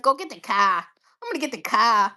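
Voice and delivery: put-on playful Boston accent, dropping the R. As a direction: imitate a Bostonian saying "car" as "cah". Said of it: "I love the Boston accent".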